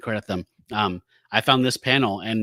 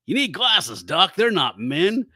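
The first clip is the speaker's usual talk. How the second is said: mocking jab, a bit louder and higher; snarky jab; 6 semitones higher, 3 dB louder than usual. Werewolf mocking the doctor, lightly voiced snark. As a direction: tease the doc with a sneer. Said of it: "doing his normal shit".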